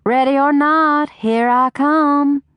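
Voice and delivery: high, slow sing-song mother calling out; sweet calling mama. A narrator's reading. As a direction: call out sweetly like a playful mama. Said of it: "the sweet voice of her only parent call".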